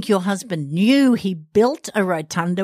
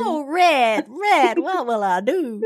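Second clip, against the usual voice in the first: hammed-up Southern lament, slow; badly faked Southern belle plea; playful Southern accent; mock Southern accent; 4 semitones higher, slower than usual. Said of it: "butchering the Southern accent".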